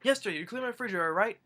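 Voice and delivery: high, fast angry questioning; mock pissed-off. Grandpa calling his ex-wife about the fridge, angrily quick. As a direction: fire off an imitated accusation. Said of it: "he's pissed about it. He calls her up".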